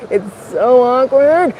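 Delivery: mortified, slow and rising in pitch; embarrassed. Real, not acted. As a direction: stretch it out, squirming with awkwardness. Embarrassed at being stared at.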